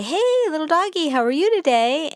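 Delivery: high, sing-song greeting to a dog; nice inviting tone. Shown as a demonstration. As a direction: coax the dog over with a bright friendly hello. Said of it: "in a nice, inviting tone of voice".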